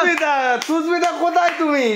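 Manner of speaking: high shouted call across a distance. Pretend. Calling out for Susmita.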